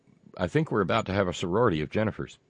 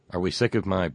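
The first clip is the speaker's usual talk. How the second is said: normal talking voice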